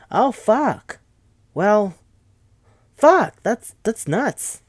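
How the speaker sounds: shocked outburst. Retold or imitated. Characters hearing Izon makes weapons, loud shocked mock outburst.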